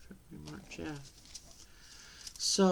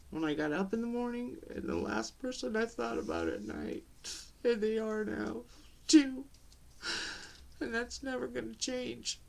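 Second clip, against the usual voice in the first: higher voice, choking up; real tearful grief; 6 semitones higher than usual. Real, not acted. Mother speaking of her lost babies, voice tearful and pitched high. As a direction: talk on while fighting back tears. Grieving mother breaking up over children.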